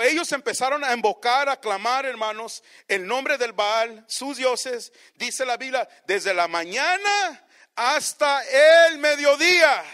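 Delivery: ordinary talk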